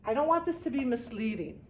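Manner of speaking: ordinary delivery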